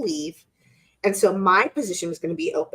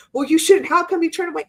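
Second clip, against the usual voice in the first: pushy counter; 7 semitones higher, 5 dB louder, faster than usual. Retold or imitated. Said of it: "I didn't counter with".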